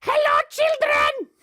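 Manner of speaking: put-on very high, loud, drawn-out old queen voice; Queen Grandma greeting. Grandma queen greeting the children, high and loud. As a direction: sing out a shrill grand welcome to the kids. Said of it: "She goes".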